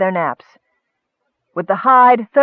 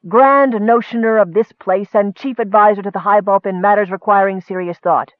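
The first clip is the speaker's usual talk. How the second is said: ordinary delivery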